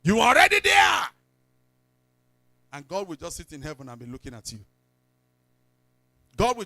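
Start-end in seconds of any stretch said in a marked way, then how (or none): 0.0-1.0 high, loud preacher's cry; a shouted promise to the flock; preaching shout. Acted. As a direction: proclaim it at full force. Preacher peaks in sermon.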